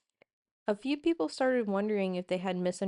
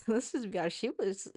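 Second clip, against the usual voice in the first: ordinary delivery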